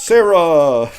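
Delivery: loud, high cry of a name; calling out. Acted. Acts out calling for Sarah.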